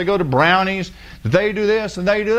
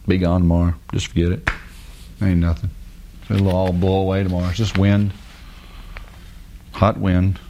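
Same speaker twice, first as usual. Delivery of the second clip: low, unhurried and reassuring, much lower in pitch; God soothing a worried believer, lower and calm; 11 semitones lower than usual. Retold or imitated. Preacher voicing God calming a riled believer, low and soothing. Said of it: "and God say".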